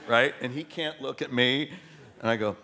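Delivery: usual speaking voice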